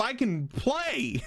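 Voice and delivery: delighted. Real, not acted. Excited about free weekend.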